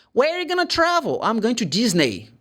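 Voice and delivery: high-pitched acted dialogue; mock tourist chat. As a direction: voice both sides of a tourist chat. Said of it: "mock dialogue tourist question".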